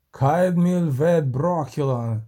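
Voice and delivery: slow, drawn-out call; mild shout. A narrator's reading. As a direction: call out the foreign words slowly. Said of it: "крикнув вершник".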